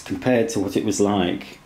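ordinary talk

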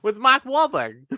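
Boston accent, slow and high. Shown as a demonstration. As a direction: drop the r's like a Bostonian. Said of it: "you see what I did there".

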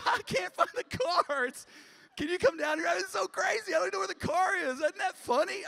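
very high, loud mock-hysterical wife; laughing wife impression. Husband imitating his wife phoning about the lost car, hysterical high squeal. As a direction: squeal it loudly, as if everything is hilarious. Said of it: "when things are going wrong, they start laughing".

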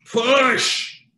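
high, drawn-out doctor's shout. Acted. Doctor urging the wife in labour, shouting. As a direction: holler it long and high at a woman in labour. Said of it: "as the doctor is shouting".

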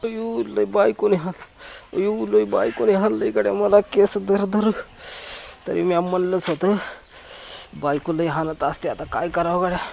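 soft moaning lament, hurting all over; groaning in pain. Acted. A soft pained moan. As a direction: beaten husband groans to himself about his wife. Beaten husband groaning to himself.

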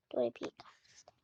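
breathy whispered curse under the breath. Real, not acted. Gamer muttering to herself, whispered curse. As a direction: hiss it to yourself, annoyed. Frustrated aside while playing.

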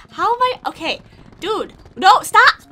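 high loud yell to stop; loud high cry of protest; shouting. Real, not acted. Snake unplugs controller.

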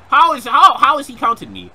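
high, loud, stammering protest; indignant disbelief. Real, not acted. Gamer being countered by a wrestler, indignant high yell. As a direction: yell the question in outrage.

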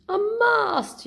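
high, loud excited cry; yelling dad. Acted. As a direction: shout it out in delight. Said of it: "yells the dad".

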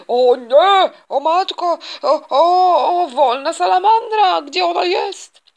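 loud, high half-sobbing cries; hammed-up shriek of dismay; panicked wailing. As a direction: wail in mock horror that the salamander is loose. Shop assistant panics over escape.

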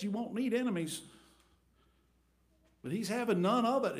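usual speaking voice

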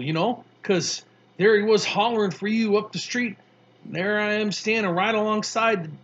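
put-on a tough kid, amused, spinning his story; Mars Bar voice.

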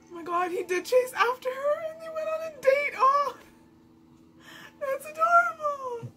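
high, loud fangirl shriek; excited squeal. Real, not acted. Fan reacting to the anime couple's date, shrieking with joy. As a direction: squeal with pure delight.

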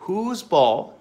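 ordinary talk

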